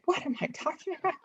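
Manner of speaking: high, quiet baffled aside; mock confusion at yourself; lightly voiced puzzled self; baffled self-quote. Retelling baffled self at night.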